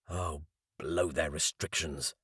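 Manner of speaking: soft, slow, under the breath; muttered defiance. A narrator's reading. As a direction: grumble it quietly to yourself.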